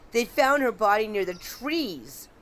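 normal talking voice

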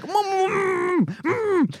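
high muffled hums through a full mouth; mm-yelling at geese with a gagged mouth. Retold or imitated. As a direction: try to yell with your mouth stuffed. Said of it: "my mouth is full".

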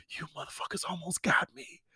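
hushed, airy retold cursing; whispered mock accusation. To the couple who had his camera, whispered mock accusation. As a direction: whisper it like a private gotcha.

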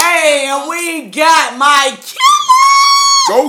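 loud, slow hype announcement; hype shout. Real, not acted. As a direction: announce the guest like a hype man. Host hypes up next guest.

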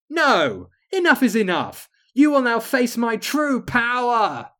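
high, loud raging villain; furious shout. Acted. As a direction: scream your defiance. Queen unleashes power.

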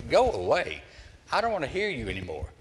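sharp high "go away", then a fast rush; fed-up brush-off. Retold or imitated. Preacher brushing off the one-upper, exasperated. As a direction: shoo the braggart off, exasperated.

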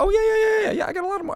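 high-pitched, eager run of yeahs; mock eager excitement. Film creator reacting to a hit that exploded, mock eager excitement. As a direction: jump up high, playing a suddenly eager creator.

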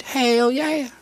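high, loud hell yeah; excited whoop. Acted. Redneck cheer.

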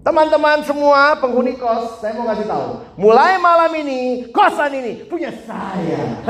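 high, loud, slowing proclamation ending in a laugh; mock loud announcement. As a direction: announce it to all the boarders like a crazy claim. Boarder's absurd loud announcement.

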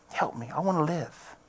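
soft, broken prayer; barely voiced, begging for life; desperate quiet plea. Man in his truck praying to God, quiet plea.

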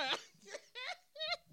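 squealing laughter; high laugh. Real, not acted. Vlogger cracking up, squealing laughter. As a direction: lose it laughing.

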